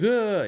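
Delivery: high, loud theatrical whoa; mock flop yelp. Imitating a player exaggerating contact to win a call, high loud yelp. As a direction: sell the contact like a flopping player. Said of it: "Maybe if he goes".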